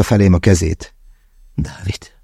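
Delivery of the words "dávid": breathy, very soft sigh of the name Dávid; barely a breath, sighing the name out. A narrator's reading. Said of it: "sóhajtotta". (sóhajtva, nagyon halkan)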